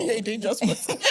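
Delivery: laughing through a teasing line; playful laugh on the words. Real, not acted. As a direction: tease her while laughing. Teasing after hearing four languages.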